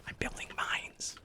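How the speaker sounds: hushed, sneaky whisper; sly snickering whisper. Pretend. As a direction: whisper like plotting a trap.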